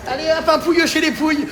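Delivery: fast, high laughing quip; laughing joke. Real, not acted. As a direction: tease with a laugh. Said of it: "Non, je rigole".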